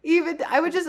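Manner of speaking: ordinary talk, nothing marked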